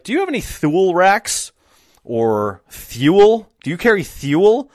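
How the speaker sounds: Thule wrongly as one syllable, "thool", "thyool"; lisped "th" in Thule, rising question; mispronouncing customer. Retold or imitated. Customer asking shop clerk for racks, Thule mispronounced "thyool". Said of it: "People would come in every day and be like".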